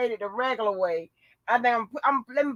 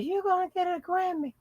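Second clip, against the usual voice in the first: high, softer incredulous quote; mimicking the voiceover; 7 semitones higher, 7 dB quieter than usual. Host repeating the Grammy line in disbelief, high mimicry. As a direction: repeat it softly in a squeaky voice. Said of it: "He done said".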